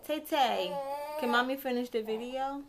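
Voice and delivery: lilting question to your little one. Real, not acted. Mommy asking her baby to let her finish, baby talk.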